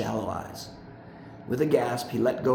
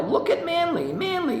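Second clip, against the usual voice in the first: much higher, excited shout of praise; cheering yell. A narrator's reading. Family jumps up cheering for Manly, excited yell. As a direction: cheer his name out like a crowd. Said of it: "they would all jump up and yell".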